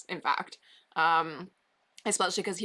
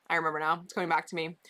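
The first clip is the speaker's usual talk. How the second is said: ordinary talk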